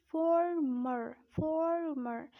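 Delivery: drawn-out American "former", both Rs strong; American accent. Shown as a demonstration. Teacher showing learners the American "former", American accent. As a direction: say 'forr-merr' with American Rs. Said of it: "But in American accent, you would say it".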